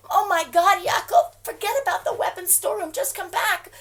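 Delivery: high, loud frightened plea; alarmed call to a husband under fire; panicked pleading. Acted. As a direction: beg him to come back in panic.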